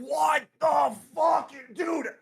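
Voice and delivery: slow, high, loud disbelieving yell; outraged shout. Real, not acted. Streamer shouting at his call guest in outraged disbelief. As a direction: stretch it out in angry disbelief.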